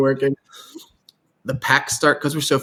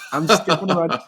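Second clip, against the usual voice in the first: laughing; 6 semitones higher than usual. Real, not acted. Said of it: "laughing while telling".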